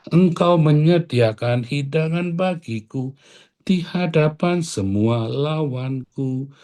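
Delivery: slow scripture recitation. Acted. Pastor recites Psalm 23 again.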